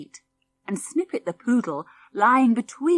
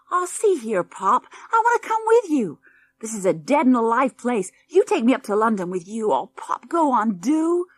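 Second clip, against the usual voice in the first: put-on whiny American boy; 4 semitones higher, 5 dB louder than usual.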